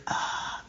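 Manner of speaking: a soft, voiceless strained ah; breathy ah of a voice that won't come; faint airless croak; voiceless rasp. Shown as a demonstration. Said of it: "if I'm talking like this".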